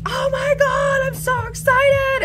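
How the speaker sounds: very high, slow, loud squeal; giddy shriek over the vote news; mock excited squeal. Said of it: "And I was just like".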